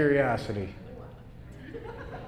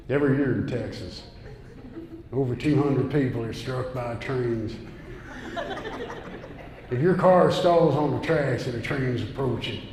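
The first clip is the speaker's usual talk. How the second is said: mock radio PSA; 3 semitones lower, 3 dB quieter than usual.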